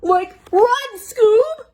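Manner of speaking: high panicked yell; Shaggy crying out. Acted. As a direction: shriek it like a scared cartoon teen. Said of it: "Shag cried".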